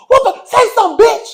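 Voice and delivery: high, loud taunt breaking into laughter; laughing mock yell. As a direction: shout it while cackling. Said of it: "and she was like".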